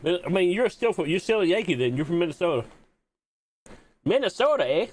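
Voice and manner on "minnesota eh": mock Minnesotan accent. Mocking Minnesotan being like Canada.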